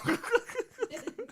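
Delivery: squealing burst of laughter; high-pitched laugh. Real, not acted. Laughing at the footage.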